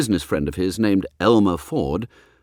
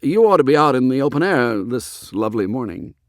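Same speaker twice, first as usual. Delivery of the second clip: mild feeble; 5 semitones higher, 5 dB louder than usual. A narrator's reading. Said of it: "he said feebly".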